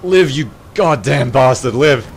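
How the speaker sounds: loud, pleading yell; desperate yelling. Real, not acted. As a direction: beg the soldier to live. Urging soldier to survive.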